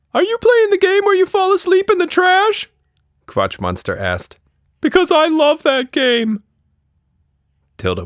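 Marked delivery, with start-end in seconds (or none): 0.1-2.7 very high, loud little creature voice; a cheeky tiny monster, full of glee; Quatch Monster voice. Acted. 4.8-6.4 very high, loud little creature voice; a cheeky tiny monster, full of glee; Quatch Monster voice. Acted.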